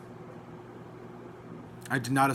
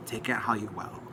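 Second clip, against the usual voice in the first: soft breathy aside, dismissive; mock whisper; 5 semitones lower, 7 dB quieter than usual. Quoting the friend's last comment to viewers, whispered. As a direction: breathe it out like a shrug.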